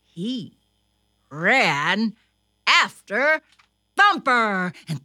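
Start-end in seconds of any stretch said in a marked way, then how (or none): none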